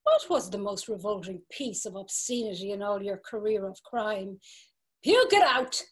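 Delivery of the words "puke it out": bullying command. Acted. Bella bullies a confession.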